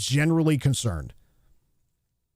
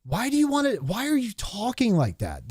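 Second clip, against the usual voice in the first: high, disbelieving plea; mock-exasperated high-pitched why; incredulous dude; 6 semitones higher, slower than usual. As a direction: ask in baffled disbelief why they talk that way. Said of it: "you're like".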